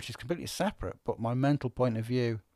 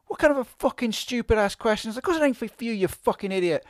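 angry middle-aged man ranting, higher and louder; red mist rant; 11 semitones higher, 5 dB louder than usual. Retold or imitated. A man masked up for the post office snaps at teenagers, angry rant. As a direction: let the red mist take over, snarling at the lads. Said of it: "in some instant red mist".

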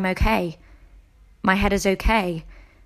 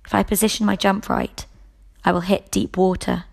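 plain reading voice

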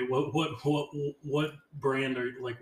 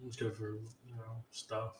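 quiet speaking; 8 dB quieter than usual. Real, not acted. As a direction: answer very softly, as if wiped out. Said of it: "you're speaking so quiet".

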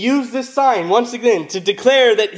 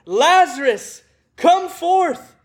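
higher-pitched, drawn-out summons; loud cry; 8 semitones higher, slower than usual. A narrator's reading. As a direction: call him out in a raised, measured voice. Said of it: "he cried with a loud voice".

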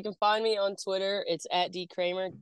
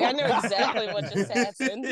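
laughing; 6 semitones higher, 4 dB louder than usual. Retold or imitated. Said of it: "I'm like".

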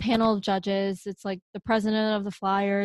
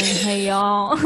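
laughing Southern greeting; Southern accent; 4 dB louder than usual. Retold or imitated. Giving the moral of her interview story, Southern accent. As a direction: toss off a Southern hello with a laugh. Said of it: "if you have a Southern accent saying".

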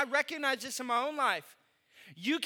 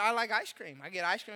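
ordinary delivery

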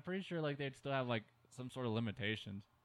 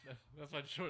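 ordinary talk, nothing marked

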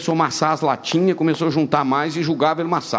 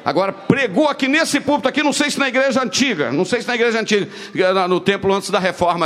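much higher preaching voice; preaching at full force; 5 semitones higher than usual. Acted. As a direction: lift it up for the story. Preaching lifted voice.

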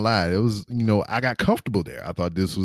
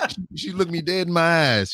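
normal talking voice

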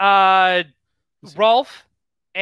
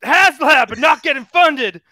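Dusty Rhodes promo; 5 semitones higher, 4 dB louder than usual. Pretend. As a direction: preach hard times like a wrestler. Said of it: "cutting a Dusty Rhodes Hard Times promo".

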